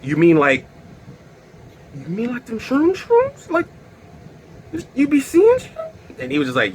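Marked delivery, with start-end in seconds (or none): 1.9-5.7 high squeaky mock disbelief; shocked disbelief. Guy realizing he ate shrooms, high shocked disbelief. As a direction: play it like the penny just dropped.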